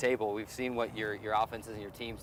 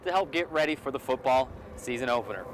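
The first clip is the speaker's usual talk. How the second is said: big, higher and louder announcer lead-in; announcer intro; 5 semitones higher, 7 dB louder than usual. Acted. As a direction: kick the segment off with a bang. Host introduces the guest.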